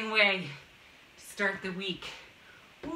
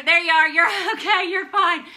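excited; 7 semitones higher, 9 dB louder than usual. Real, not acted. Greeting returning student loudly.

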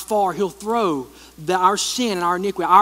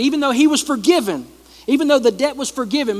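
rising preaching; 6 semitones higher, 5 dB louder than usual. Real, not acted. Preaching builds up.

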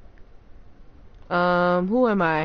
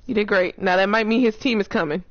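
usual speaking voice

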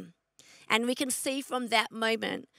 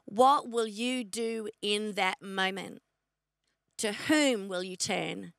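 slow, lowered question; 3 semitones lower, slower than usual. Acted. Preacher's repeated searching question.

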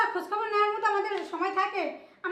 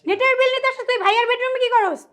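loud, raised disbelieving question; angry challenge; 3 semitones higher, 5 dB louder than usual. Acted. Woman challenges man in brother's bedroom, angry disbelief. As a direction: throw the excuse back at him loudly.

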